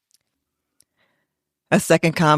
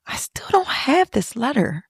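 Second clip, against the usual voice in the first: whisper; 10 semitones higher than usual. Retold or imitated. Admissions woman, retold line.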